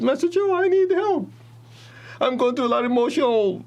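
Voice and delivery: high, pleading whine as a desperate student; whiny pleading student. Retold or imitated. Student begging his teacher in a high pleading whine. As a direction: beg the master in a thin high voice.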